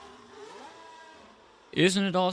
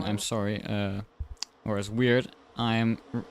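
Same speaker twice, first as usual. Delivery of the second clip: ordinary delivery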